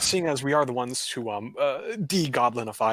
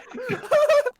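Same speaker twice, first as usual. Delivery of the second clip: high giggle; 5 dB louder than usual. Real, not acted. Table cracks up at the bit.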